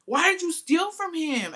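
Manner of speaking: high, loud, slow exasperated question; slow high exasperated demand; incredulous. Retold or imitated. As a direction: drag it out in disbelief. Scolding friend over the theft.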